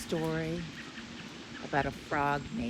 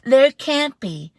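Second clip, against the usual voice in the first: higher, loud, slow; denying mother; insisting mom; 9 semitones higher, 15 dB louder, slower than usual. Acted. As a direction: insist it can't be.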